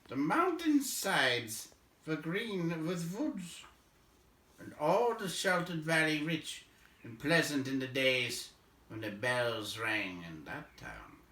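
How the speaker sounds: mournful dwarf looking over ruined Dale; old dwarf Balin. Acted. Balin tells Bilbo of Dale's past, slow and grave.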